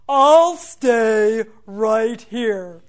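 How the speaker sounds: very slow, loud tree voice; chuckle, then stretch every word loudly; talking tree. Acted. Tree answering the leprechaun, slow and booming. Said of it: "And the tree said".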